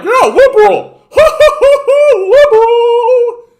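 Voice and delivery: very high, loud, laughing cartoon voice; squeaky idiot voice, drawn out; cartoonish shrieking mock voter; dopey mock voter. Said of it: "we're still like".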